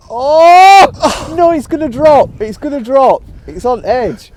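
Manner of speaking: high, loud excited shouting; willing the ball into the hole. Real, not acted. Watching putt on the edge.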